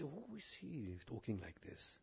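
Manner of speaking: hushed, slow mock muttering; muttered disapproval. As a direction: imitate a listener grumbling under the breath. Voices an offended listener's reaction.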